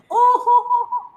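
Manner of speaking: high, loud mock ho ho ho; mock laugh. Husband laughing off the family's teasing, mock laugh. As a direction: fake a jolly laugh. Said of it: "He's like".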